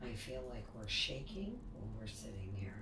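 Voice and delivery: hushed whisper. Real, not acted. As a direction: whisper it, nervous. Scared whisper in haunted room.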